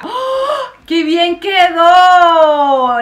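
high, slow, loud gush of delight; excited shout. Real, not acted. Vlogger reacting to a finished makeover, excited shout. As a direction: shout it out, thrilled by the result.

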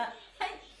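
normal talking voice

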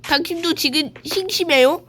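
ordinary talk, nothing marked

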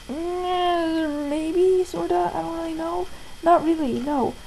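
hesitant hedging. Retold or imitated. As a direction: hedge it quietly, unsure. Hedging answer to stepsister's label.

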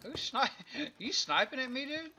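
laughing. Real, not acted. Laughing at being sniped.

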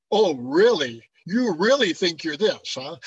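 loud, mock-incredulous sarcasm; sarcastic. Shown as a demonstration. Teacher showing how we signal sarcasm, sarcastic. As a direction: challenge them with loud, exaggerated disbelief. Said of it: "we're really talking sarcastically".

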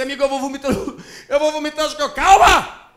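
a woman about to throw up in panic; panicked sick girl. Acted. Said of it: "Ela fica assim".

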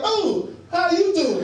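high, drawn-out gushing hello; mock-dainty, singsong welcome; unmasculine greeting. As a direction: greet her all fluttery and high. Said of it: "You lose that credibility".

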